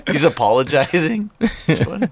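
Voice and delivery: helpless high-pitched laughing speech; cracking up. Real, not acted. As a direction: barely get it out through laughter. Cracking up at the film joke.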